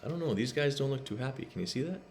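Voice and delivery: ordinary delivery, nothing marked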